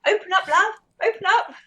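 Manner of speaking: high, coaxing call to a model; mock camera direction. Guest teases husband about filming his wife, mock directing. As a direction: playfully direct someone in a high voice.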